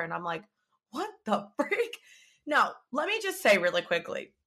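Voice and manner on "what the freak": high, drawn-out disbelief; incredulous outburst. Retold or imitated. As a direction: stretch it out slowly, pitched way up. Retold reaction to betrayal.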